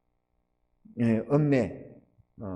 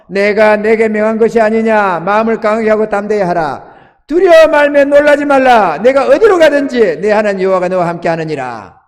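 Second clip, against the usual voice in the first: louder, ringing proclamation of the verse; preached scripture; 3 semitones higher, 8 dB louder than usual. Acted. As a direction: declare it to the whole church with force. Proclaims Joshua 1:9 loudly.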